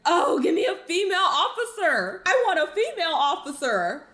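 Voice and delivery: hammed-up entitled plea, laughing at her; a mocking, laughing imitation of her demanding a female officer; mocking whiny demand. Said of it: "now all of a sudden it's".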